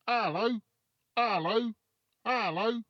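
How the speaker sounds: high parrot voice, rising each time; high repeated parrot greeting; calling parrot. A narrator's reading. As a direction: call like a parrot from up a chimney. Parrot calls from chimney.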